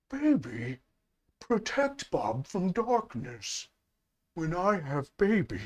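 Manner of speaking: very soft, halting creature voice; tender Bob. Acted. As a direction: murmur gratefully as a gentle, simple monster. Plays Bob, moved, holding baby.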